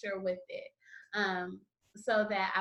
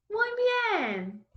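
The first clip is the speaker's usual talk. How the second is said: excited praise; 12 semitones higher, 5 dB louder than usual. Real, not acted. Teacher praises repeated syllables.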